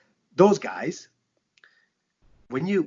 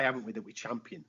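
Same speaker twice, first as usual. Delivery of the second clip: ordinary talk, nothing marked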